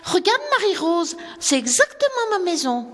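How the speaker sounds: excited. Acted. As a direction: show her sister, delighted and high. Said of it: "s'écria celle-ci". (fillette émerveillée, voix beaucoup plus aiguë)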